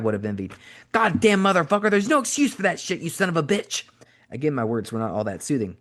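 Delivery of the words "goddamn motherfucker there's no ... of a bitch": furious yelling. A narrator's reading. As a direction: roar the curses at him, burned and raging. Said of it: "a string of obscenities".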